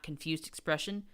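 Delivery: usual speaking voice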